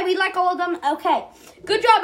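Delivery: usual speaking voice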